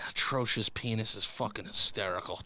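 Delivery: ordinary talk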